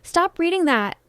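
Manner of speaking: lightly acted indignant protest; angry outburst. A narrator's reading. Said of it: "Cody slams his fist on the table".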